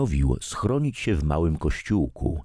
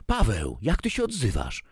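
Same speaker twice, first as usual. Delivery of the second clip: higher, a mother's sharp rebuke; scolding mother; 7 semitones higher than usual. A narrator's reading. Mother scolds son for mocking God, sharp rebuke. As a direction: rebuke her son's rudeness. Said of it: "skarciła go matka".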